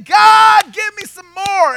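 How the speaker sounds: loud, high, greedy plea; demanding shout at God. Retold or imitated.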